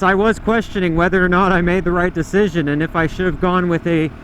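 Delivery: ordinary talk, nothing marked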